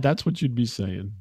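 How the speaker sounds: normal talking voice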